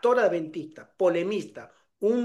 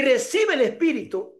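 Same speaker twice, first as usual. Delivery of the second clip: high-pitched mimicked pastor; insistent preacher; 5 semitones higher than usual. Pastor cornering the newcomer, insistent mimicked command. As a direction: imitate an insistent faith healer. Imitated pastor pressing him.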